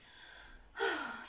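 wistful sigh. Acted. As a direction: sigh with longing.

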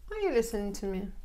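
quick connected reading; fast natural repeat; natural speed question. Shown as a demonstration. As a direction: say it the way it really sounds. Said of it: "teacher repeats question at speed".

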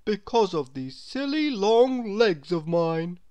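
lightly voiced, doleful character; glum tall man. A narrator's reading. Mister Tall answers Mister Small, mildly gloomy.

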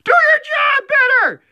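very high, drawn-out screamed order; mock inspirational speech. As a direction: scream it slowly as the whole speech. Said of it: "Here's my speech".

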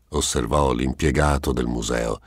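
plain reading voice

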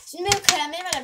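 high, loud where-are-my-pens cry; exasperated question. Real, not acted. Girl hunting for pens, high loud exasperated question. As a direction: demand it to the room in frustration. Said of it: "Ben onu çözemiyorum şu an".

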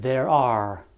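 There are said the English way, TH and linked R; careful model of There are. Shown as a demonstration. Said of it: "pronunțați această structură corect".

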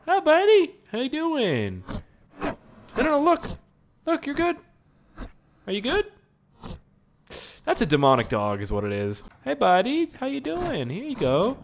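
high, tender talk to a dog; sweet pet voice. Real, not acted. Gamer soothing a dog in pet talk. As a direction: coo at the puppy.

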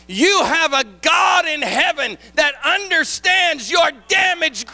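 building, shouted sermon cadence; preacher rousing the congregation, shouting; preaching. Real, not acted. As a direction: drive it home like a preacher in full flight. Preaching at full force.

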